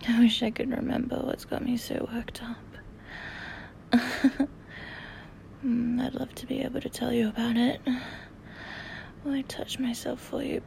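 breathy close-mic whisper. Real, not acted. Intimate whispering throughout.

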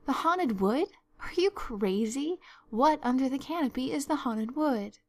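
high, incredulous questioning; astonished demand. Acted. Marilla demands what the haunted wood is, astonished. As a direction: question it as utter nonsense.

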